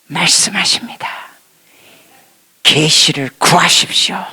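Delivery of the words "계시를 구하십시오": whispered solemn urging; a touch of whispered prophecy; hushed spirit voice. Retold or imitated. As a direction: pass on a hushed exhortation. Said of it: "성령께서 말씀하십니다".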